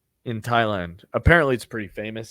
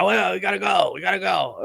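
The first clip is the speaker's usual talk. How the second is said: hurried, higher, urgent driver voice; a hint of the Thai driver; 6 semitones higher than usual. Driver rushing the tourist out of his hotel, hurried and urgent. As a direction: hurry everyone along like an impatient driver. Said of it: "He's like".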